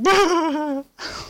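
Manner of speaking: high, loud imitated bawling; mock sobbing wail. Telling the co-host about crying at the soundtrack, mock bawling. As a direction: wail like you're overcome by a song. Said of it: "and I was like".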